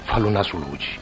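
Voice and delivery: softly swelling dialect poem recital; theatrical poetry reading. Acted. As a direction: recite it like a stage poem, drawing out each line. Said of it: "recitare una poesia".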